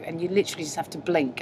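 ordinary talk

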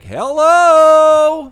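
very high, loud hype-man yell; hype man shout. Pretend. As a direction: pump up the crowd with a huge hello. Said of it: "I'm gonna be like".

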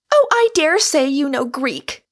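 high, loud breezy dismissal; cheerful inaccuracy. A narrator's reading. Angered Rosamond mistakes Michael's Latin for Greek, cheerfully cutting. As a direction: toss it off loudly with confident cheer. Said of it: "she said with cheerful inaccuracy".

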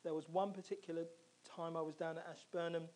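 ordinary delivery

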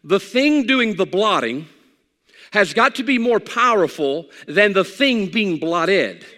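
slow and deliberate, word by word; teaching it carefully, slowly; slow principle. Shown as a demonstration. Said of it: "If I give you a principle".

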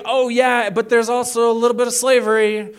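high, loud, offhand voice; sheepish caller. Retold or imitated. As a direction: slip it in casually. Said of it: "and then be like".

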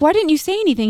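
retold frustration at a child; frustrated. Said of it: "I'm frustrated".

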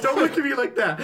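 high, through real laughter; laughing protest. Real, not acted. Prankster pitching to skeptical friends, laughing protest. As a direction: crack up while objecting. Teller cracks up at teammates' reactions.

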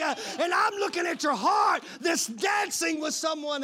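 loud, high shouted preaching; drawn-out pulpit shout; full-force preaching. Real, not acted. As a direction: thunder God's words at the congregation.

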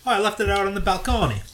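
a quick mock Italian housewife bit. Joking to his cohost in a mock Italian voice.

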